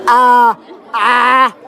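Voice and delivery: loud open-mouthed ah to show an empty mouth; showing the doctor there is nothing in his mouth. Retold or imitated. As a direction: say ah wide open like at the dentist. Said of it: "I can be like".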